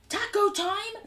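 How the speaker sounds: loud, high American cowboy holler; Kentucky cowpoke accent. Acted. English streamer faking an American, Kentucky cowpoke accent. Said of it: "like a Kentucky horse riding cowpoke".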